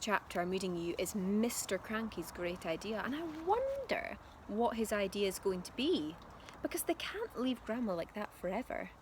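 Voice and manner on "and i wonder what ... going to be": plain reading voice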